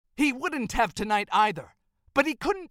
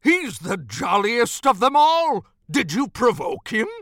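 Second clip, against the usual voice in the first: loud, protesting Santa voice; disbelieving Santa; 6 dB louder than usual. Acted. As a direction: protest loudly, in shocked disbelief. Santa disbelieves the injured elf.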